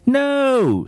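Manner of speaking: a high screamed No. A narrator's reading. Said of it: "screamed Missus Scorf".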